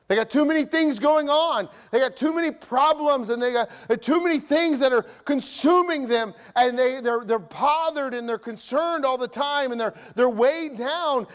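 impassioned high preacher's cry; fiery sermon delivery; preaching. Acted. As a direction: preach it hard to the congregation. Preaching at full force.

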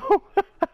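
high, loud laugh; burst of laughter. Real, not acted. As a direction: laugh it off, amused. Laughing at the enemy.

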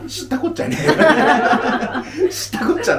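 high-pitched laughing retort; jokey, exasperated comeback; mock brush-off. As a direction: blurt it out high, as a comic refusal to care. Mock retort to asked dinner question.

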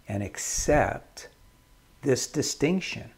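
ordinary talk

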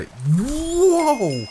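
rising high, loud whoa; amazed whoa. Real, not acted. Player seeing something huge, amazed cry. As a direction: let it swoop up in astonishment.

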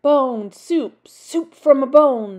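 usual speaking voice

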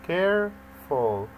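"careful" slowly in two separate beats, care, ful; teacher sounding out "careful" syllable by syllable; split into syllables. Shown as a demonstration. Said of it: "يعني فيها two syllables".

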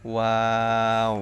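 ওয়াও with both lips rounded; careful demonstration of round-lipped ওয়াও; rounded-lip letter. Shown as a demonstration. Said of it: "শেষেও গোল হবে দুই ঠোঁট".

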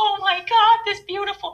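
put-on high breathless swoon of a starstruck teen; high, starstruck gasp. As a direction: gush it like a smitten seventeen-year-old. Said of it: "and I think".

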